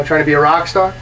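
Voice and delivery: ordinary talk, nothing marked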